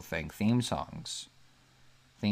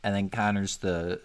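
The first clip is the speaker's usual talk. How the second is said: usual speaking voice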